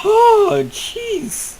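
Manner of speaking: high shaken gasp; frightened gasp. Real, not acted. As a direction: catch your breath after a fright. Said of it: "That was terrifying".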